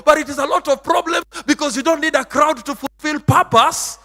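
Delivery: high, loud, sing-song preaching; fiery preaching. Acted. Pastor preaching to his flock, loud and chanting. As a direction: preach it out to the congregation.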